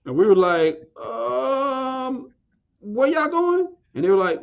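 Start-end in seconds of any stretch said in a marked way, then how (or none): 1.0-3.8 much higher mock-naive voice asking back; clueless teen. To the girls in English class, mock clueless. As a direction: play dumb, pitch way up like a confused kid. Mocking his own clueless reply.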